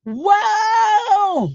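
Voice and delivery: high shouted whoa, full of disbelief; shocked whoa. Real, not acted. Shouting in shock at the witness's testimony. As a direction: cry out in shock.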